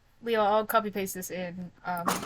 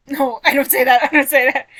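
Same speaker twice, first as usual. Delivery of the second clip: loud laughing denial; much louder, laughing objection; laughing protest; 4 semitones higher, 12 dB louder, faster than usual. Real, not acted. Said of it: "laughing protest".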